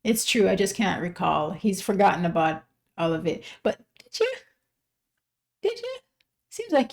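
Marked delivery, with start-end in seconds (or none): 3.6-6.9 high, teasing "did you?"; teasing high question. Acted. To the singer, teasing whether he remembers, squeaky and high. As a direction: poke at him with a squeaky doubt.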